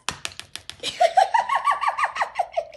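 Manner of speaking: very high cartoon giggle; squeaky naughty laugh; mischievous giggle. Mischievous laugh after temptation.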